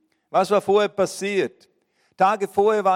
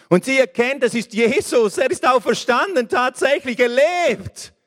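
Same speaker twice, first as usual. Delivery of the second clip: higher and louder, rising in joy; joyful exclamation; 6 semitones higher, 3 dB louder than usual. A narrator's reading. Preacher telling of Maria recognising the risen Jesus, joyful and loud. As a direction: burst out with the news that he lives.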